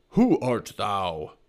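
slow, stern demand; drawn-out, indignant question; angry challenge. A narrator's reading. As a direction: challenge an intruder, drawing out each word. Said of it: "he asked angrily".

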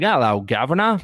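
a hammed-up British accent, like a Londoner; bad British accent. Imitating a friend back from London, bad British accent. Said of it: "that was a horrible, horrible impression".

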